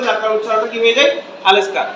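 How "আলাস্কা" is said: "আলাস্কা" quick, as a single word; fast native linking. Shown as a demonstration. Teacher explains native speed, quick linked "আলাস্কা". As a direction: run the words together as "আলাস্কা". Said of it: "এরা খুব দ্রুত বলে".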